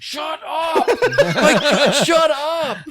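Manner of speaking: high, loud yell breaking into laughter; exasperated shout. Retold or imitated. Dad re-enacting snapping at his chattering son, exasperated high shout. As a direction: re-enact a fed-up dad's outburst, laughing.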